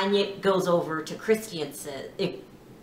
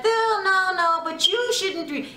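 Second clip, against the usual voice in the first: mock protest; 10 semitones higher than usual. Retold argument, Tanya protesting.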